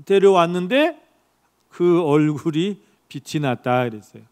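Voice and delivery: usual speaking voice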